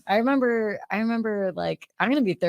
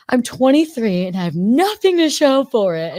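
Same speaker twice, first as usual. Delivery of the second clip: whining complaint; 6 semitones higher, 8 dB louder than usual. Retold or imitated. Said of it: "I was like".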